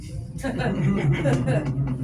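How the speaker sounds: high breathy laugh at the punchline. Real, not acted. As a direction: crack up, mostly air.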